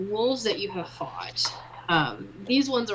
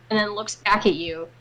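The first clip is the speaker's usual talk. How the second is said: ordinary delivery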